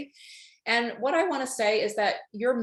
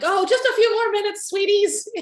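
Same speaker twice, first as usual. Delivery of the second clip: put-on high, loud sing-out to the children; calling to kids; 12 semitones higher, 7 dB louder than usual. Working mom putting off her kids, high loud call. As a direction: imitate a busy mom calling through to another room.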